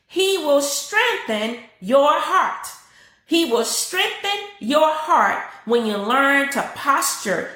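strengthen your heart drawn out slow; slow emphatic preaching. Real, not acted. Pastor urging the church to repeat, slow emphatic preaching. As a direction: preach it slowly, each word weighted. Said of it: "someone repeat that".

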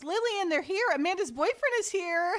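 high, sing-song call up the stairs; calling upstairs. Retold or imitated. Mother announcing guests up the stairs, high calling voice.

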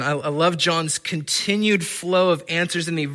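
ordinary delivery

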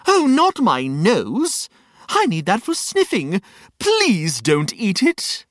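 lightly hammed-up worried plea; a touch of comic alarm, drawn out; alarmed Reg, pleading. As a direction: beg slowly to spare your nose. Character fears for his nose.

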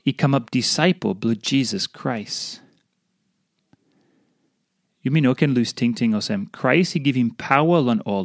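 ordinary talk

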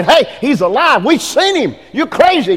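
giddy disciples arguing in the street; excited disciples. Acted. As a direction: act out the disciples shouting the news.

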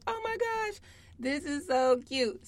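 high, delighted gushing; gushing mom. Retold or imitated. Mom gushing over her daughter's doll, high and delighted. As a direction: squeal over something adorable. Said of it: "I'm like".

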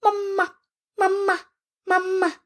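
high doll voice calling mama; like a toy doll repeating itself; talking doll. A narrator's reading. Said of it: "The doll said".

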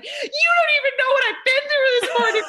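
very high, loud, tearful shrieking rant; hammed-up screaming. Mom having a meltdown at the secretary, screaming and bawling. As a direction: wail it like a hysterical meltdown, played for laughs. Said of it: "I'm screaming back at her".

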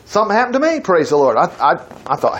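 usual speaking voice

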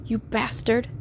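contained, hushed fury; hissed. A narrator's reading. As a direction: hiss the insult under the breath.

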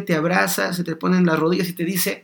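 normal talking voice

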